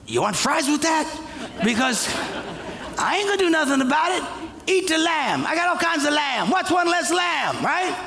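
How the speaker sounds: hammed-up careless bravado; mock indifference. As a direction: shrug off the bear with sarcasm. Preacher mocking his own cowardice.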